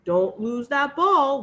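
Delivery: loud firm fatherly warning; the king warns his daughter sternly. Acted. King warns princess, loud and stern. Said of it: "warned the king".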